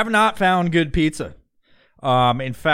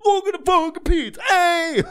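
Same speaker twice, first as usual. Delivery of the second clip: mock Guido accent. Mocking Guidos showing off pizza, high Guido accent. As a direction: crow it like a New York caricature. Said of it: "It's always like this".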